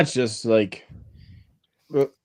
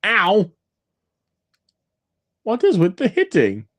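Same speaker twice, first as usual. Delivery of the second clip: pained yelp; 10 semitones higher, slower than usual. Real, not acted. Hit in game, crying out.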